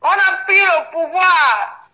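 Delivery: high, slow declaration; triumphant proclamation. Real, not acted. Streamer announcing they seized power, triumphant proclamation.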